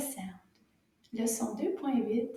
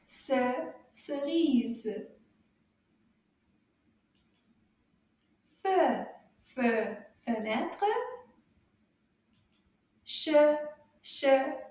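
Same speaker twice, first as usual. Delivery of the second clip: slow reading drill; 4 dB louder, much slower than usual. Shown as a demonstration. Teacher reads the poem slowly for children to echo. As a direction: clear syllable-by-syllable chant for kids to repeat. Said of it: "read the slides very slowly".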